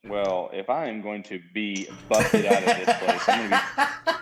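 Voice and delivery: laughing while talking. Real, not acted. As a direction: chuckle through the words. Laughing about going broke.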